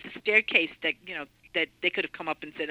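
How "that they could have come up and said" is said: usual speaking voice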